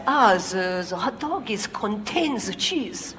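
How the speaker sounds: struggling to explain in a lightly put-on French accent. French engineer explains the cheese.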